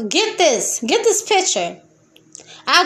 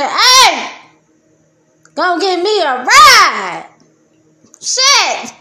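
calling out; 4 semitones higher, 6 dB louder, slower than usual. Acted. Calling out for a ride.

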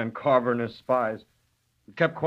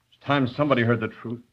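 ordinary talk

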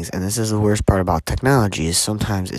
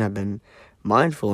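normal talking voice

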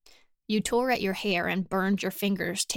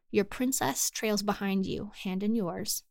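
ordinary delivery, nothing marked

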